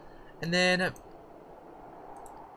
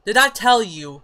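higher, louder follow-up; loud call; 5 semitones higher, 12 dB louder than usual. Real, not acted. Player still calling to her sim, loud. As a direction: keep shouting for attention.

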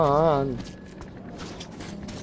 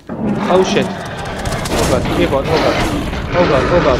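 panicked; 6 dB louder, slower than usual. Real, not acted. Monster appears suddenly.

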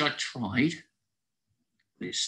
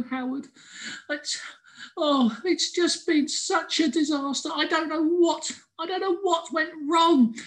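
much higher, tearful, near crying; whimpering squirrel. A narrator's reading. Squirrel covered in paint telling Howard, whimpering. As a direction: whimper out the disaster, voice breaking. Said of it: "he whimpered".

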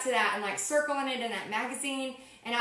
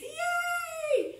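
high squealed yay; excited cheer. Real, not acted. For a viewer with sixteen prepaid guests, a high squealed cheer. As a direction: cheer for her like it's huge news.

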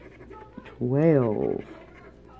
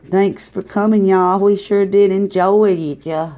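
loud, high, friendly Southern goodbye; hammed-up hospitable farewell; folksy send-off; 6 semitones higher, 9 dB louder than usual. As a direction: call it out like a host seeing guests off. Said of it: "don't know why I like to say that".

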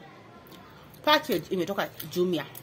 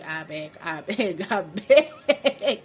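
drawn-out begging that tips into a laugh; laughing plea; 4 dB louder, much slower than usual. Real, not acted. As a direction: beg slowly, then burst out louder into laughter. Joking plea breaking into laughter.

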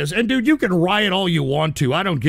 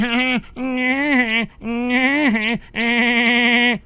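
long, higher-pitched drawn-out laugh; mock gloating laugh; 4 semitones higher than usual. Host mimics the winning chat, with a mocking laugh. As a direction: imitate a gloating troll's cackle at length.

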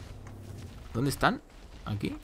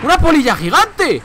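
high, loud cry of shock; shrieking in surprise; startled shriek; 13 semitones higher, 10 dB louder than usual. Real, not acted. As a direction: yelp at the giant moth. Surprised at giant moth in game.

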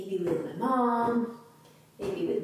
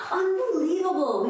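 usual speaking voice

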